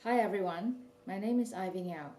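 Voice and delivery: usual speaking voice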